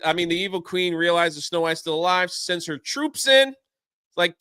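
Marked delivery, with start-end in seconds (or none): none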